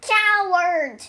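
high, loud taunt; yelled insult. A narrator's reading. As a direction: yell it out across the river. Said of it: "and yelled at the river".

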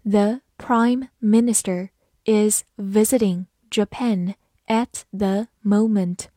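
slow, careful dictation reading. Shown as a demonstration. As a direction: read it slowly word by word. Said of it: "跟着我慢读一遍". (慢读)

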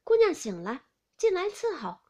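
loud summons to another room; calling out. A narrator's reading. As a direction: call a maid in from outside. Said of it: "便叫紫娟说".

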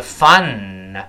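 "fun" with a long "n" released into a faint "nuh"; released final n. Shown as a demonstration. Teacher imitates some native speakers, "fun" ending in "n-nuh". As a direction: draw out "fun", then open the mouth after the "n". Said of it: "说完之后又张开了".

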